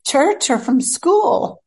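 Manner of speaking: lightly voiced husband asking in earnest; puzzled husband. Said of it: "He says".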